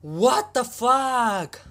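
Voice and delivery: drawn-out stunned high shout; shocked yell. Real, not acted. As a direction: scream it in total disbelief. Shocked reaction to anime.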